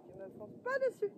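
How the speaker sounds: usual speaking voice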